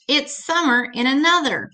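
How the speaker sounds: ordinary talk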